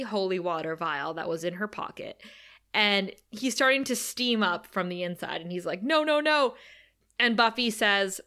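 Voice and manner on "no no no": higher, louder panicked no; mock panicked no. Podcaster mimics vampire's panicked cry. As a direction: play the dying vampire's panic. Vampire steaming from holy water.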